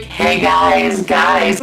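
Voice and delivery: low, loud slurred calling out; drunk call. Retold or imitated. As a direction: call out to friends, sloppy and drunk.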